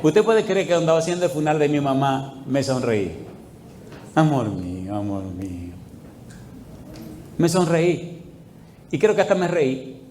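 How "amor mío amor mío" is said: low murmured affection, softening; quiet, intimate address; tender endearment. Retold or imitated. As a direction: speak lovingly in a lowered voice. Endearment recalled from mother's funeral.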